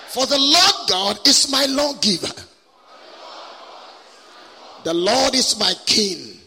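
loud, ringing call-and-response lines; passionate declaration. Shown as a demonstration. Preacher leads congregation reciting the verse, passionate declaration. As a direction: declare it with passion, leaving room for echoes. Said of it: "say it with passion".

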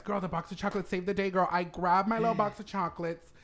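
normal talking voice

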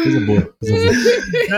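riso agudo e arrastado, quase chorando; rindo agudo. Real, not acted. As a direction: solte as palavras no meio de uma gargalhada aguda. Crise de riso aguda. (rindo até chorar)

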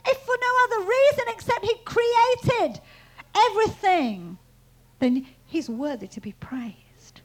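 loud, high, emphatic proclamation; forceful preaching. Acted.

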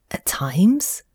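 whispered, hesitant question; a timid whispered question; timid ask. A narrator's reading. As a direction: venture it shyly under your breath. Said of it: "the mole ventured to ask".

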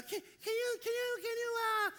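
very high cartoon squeak; Mickey Mouse. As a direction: stammer timidly in a high falsetto. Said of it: "little Mickey Mouse".

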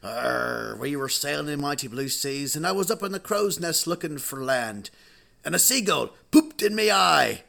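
hammed-up sea-dog tale, building at the end; pirate. Pirate tells how he lost his eye, building pirate yarn. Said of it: "there was a real life pirate".

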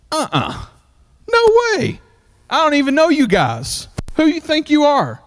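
high, scoffing brush-off; mocking high-pitched snub of strangers; scoffing dismissal. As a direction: play the rude rich man waving them away. Preacher voices rude Nabal's refusal.

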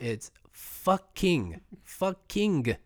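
slow, "fucking" stretched out sound by sound; dragged-out fucking. Shown as a demonstration. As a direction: draw out "fucking" to a bitter end. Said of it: "every phonetic sound ... to a bitter end".

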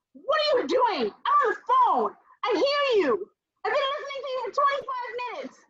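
high-pitched, indignant retelling; exasperated confrontation. Retold or imitated. Daughter confronting mom about open phone, exasperated. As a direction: pitch it way up in disbelief.

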